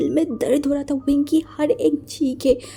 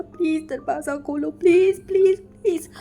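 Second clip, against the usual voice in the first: sobbing plea. Acted. Wing sobs at the locked door, crying and begging. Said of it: "फूट-फूट के रो रहा था, चिल्ला रहा था".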